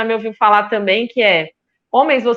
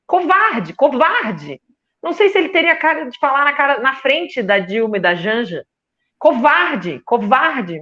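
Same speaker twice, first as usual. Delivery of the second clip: higher-pitched, emphatic real indignation; scornful, insistent condemnation with raised pitch; indignant denunciation; 5 semitones higher than usual. Real, not acted. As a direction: hurl the accusation at an absent man. Denouncing a politician as a coward.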